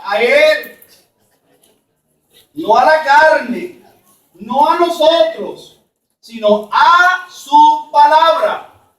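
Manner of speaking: normal talking voice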